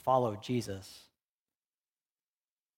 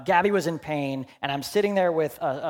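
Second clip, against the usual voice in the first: ordinary talk, nothing marked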